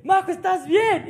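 slow, high call from far ahead; brother calling out. Retold or imitated. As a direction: call back to check on her, as her brother. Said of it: "mi hermano me cansa escuchar porque estaban muy adelantados". (llamándola desde lejos, como su hermano)

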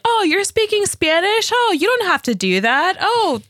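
high, teasing imitation of parents; mock-impressed parents. Speaker imitating parents teasing their Spanish, high mock surprise. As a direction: tease with exaggerated, high-pitched surprise.

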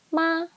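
"ma" high and level; high-level first tone. Shown as a demonstration. Teacher running through tones, "ma" high and level. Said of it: "first tone".

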